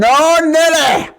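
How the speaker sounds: put-on very high, loud, drawn-out English-accent holler; hammed-up English accent. Host echoing his co-host's uncle story, yelled mock English accent. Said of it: "If I hear something".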